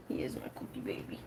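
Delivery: fast, soft whisper to the dog. Real, not acted. Whispering to her dog on stream, fast and hushed. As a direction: breathe it out like a secret.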